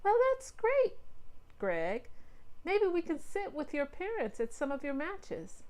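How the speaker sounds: put-on cheerful mom chatting to the boys; mom's voice. A narrator's reading.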